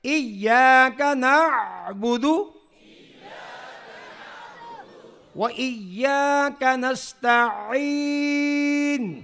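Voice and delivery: slow, drawn-out chanted recitation; Quran recitation. Shown as a demonstration. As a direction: stretch each word in tajweed chant. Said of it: "آواز کو ذرا اونچا کریں".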